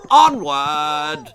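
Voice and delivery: loud rallying bellow. A narrator's reading. Lord Percy bellowing at his hounds. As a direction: drive the hunt on with a roar. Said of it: "bellowed Lord Percy".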